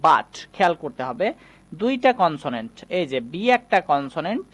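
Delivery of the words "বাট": "বাট" pronounced as "baat", not "boot"; BUT with "a" vowel. Shown as a demonstration. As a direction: say "বাট" with an open a. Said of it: "এটার উচ্চারণ করতে হবে বাট".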